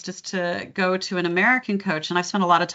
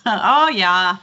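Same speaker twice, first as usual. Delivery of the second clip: louder Fargo "oh yah"; Fargo accent; 6 dB louder than usual. Shown as a demonstration. Coach playing up the sound, Fargo accent. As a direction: round the O like a Minnesotan. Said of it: "You get the O sound".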